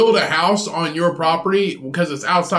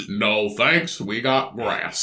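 lower, quieter, slow refusal; 8 semitones lower, 5 dB quieter, much slower than usual. Retold or imitated. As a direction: turn the clerk down like a gruff farmer.

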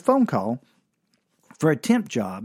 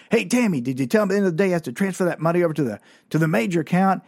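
high, fast call to a coworker; boss yelling out; 4 semitones higher, much faster than usual. Retold or imitated. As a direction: imitate a weirdo boss rattling off a reminder. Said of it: "that weirdo boss would yell out".

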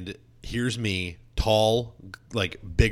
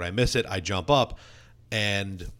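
ordinary talk

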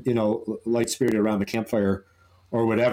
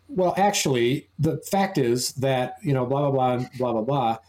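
put-on precocious little boy; 2 semitones higher than usual. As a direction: imitate a child lecturing like an adult. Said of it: "his speech patterns, way ahead of his age".

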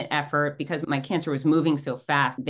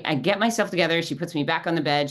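ordinary talk, nothing marked

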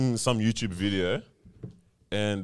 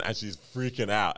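ordinary delivery

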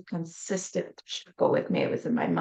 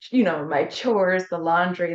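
ordinary talk, nothing marked

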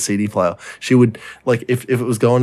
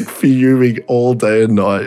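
ordinary talk

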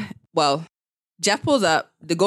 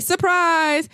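excited surprise; 10 semitones higher than usual. Retold or imitated. Retold party surprise.

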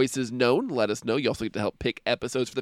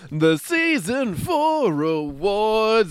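gushing red carpet chatter; 5 semitones higher, 4 dB louder, slower than usual. Pretend. Mock awards show host intro.

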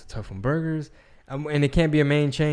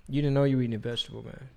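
ordinary talk

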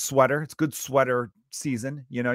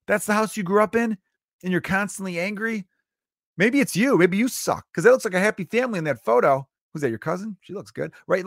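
high, incredulous jabs; 6 semitones higher than usual. Acted. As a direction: needle the whiner with disbelief. Mocking complainers.

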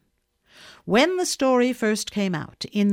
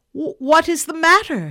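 loud, startled husband's cry; alarmed cry; 8 semitones higher, 9 dB louder than usual. A narrator's reading. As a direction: cry it out in shock. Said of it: "he cried".

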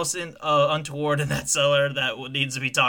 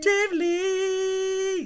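teasing singsong. Acted. Teasing about a crush.

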